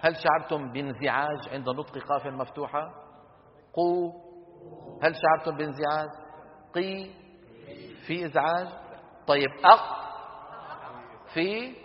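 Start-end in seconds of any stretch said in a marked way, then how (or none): 3.7-4.1 قو with the ق opening into u; qaf with damma. Shown as a demonstration. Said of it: "قولوا مثلي لو سمحتم". 6.7-7.1 قي with the ق opening into i; qaf with kasra. Shown as a demonstration. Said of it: "قولوا مثلي لو سمحتم". 9.7-10.0 أق with the q held closed, no release; held stopped qaf. Shown as a demonstration. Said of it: "قولوا مثلي لو سمحتم".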